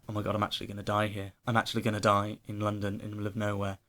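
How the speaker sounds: low, hurried panicky self-talk; quietly fretting to oneself; retold freak-out. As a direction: reenact a hushed, fast spiral of dread. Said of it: "freaking out again even more".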